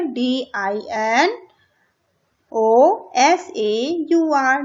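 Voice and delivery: letter by letter, very slowly; slow spelling. Shown as a demonstration. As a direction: spell it out carefully for children. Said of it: "क्या spelling होती है?". (अक्षर-अक्षर धीरे बोलकर)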